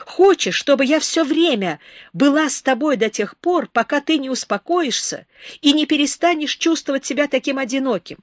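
put-on high, loud, urgent young woman confessing; Beba pleading. A narrator's reading. Beba offers herself to Herardo, high and loud. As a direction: beg him to let you stay.

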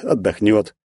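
plain reading voice

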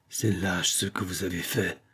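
a dull, smothered reproach; muffled murmur. A narrator's reading. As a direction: murmur it low through wounded pride. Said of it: "murmura enfin Aimé d'une voix sourde".

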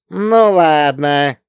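put-on slow, drawn-out childish sigh of consent; grudging little girl. A narrator's reading. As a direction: give in like a sulky child doing a favour. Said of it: "сделала одолжение доченька".

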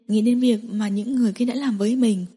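ordinary delivery

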